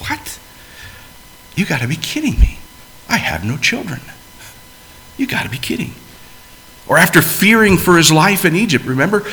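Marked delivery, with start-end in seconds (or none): 0.1-5.9 incredulous Abraham. Retold or imitated. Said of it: "I just picture Abraham saying".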